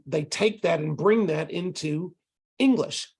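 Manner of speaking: ordinary delivery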